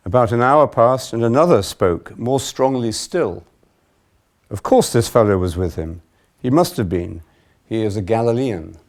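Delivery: loud, projected Gospel narration; dramatic public Bible reading; scripture reading. A narrator's reading. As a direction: read it aloud with firm, emphatic quotes. Reads the Gospel passage aloud.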